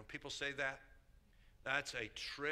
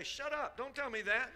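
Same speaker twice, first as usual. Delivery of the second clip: high mock snap; retold retort; 10 semitones higher than usual. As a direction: brush them off sharply. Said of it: "I used to say".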